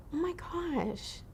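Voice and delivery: slow, soft, high-pitched retold reaction; acted-out quiet astonishment; hushed amazement. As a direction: breathe it out slowly, softly stunned. Acting out being moved by confession.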